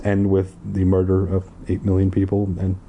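ordinary delivery

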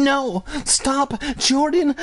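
put-on very high girl's cry; high frantic little-sister yell; screaming. A narrator's reading. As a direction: plead in a squealed, half-voiced scream. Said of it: "Ashley screamed".

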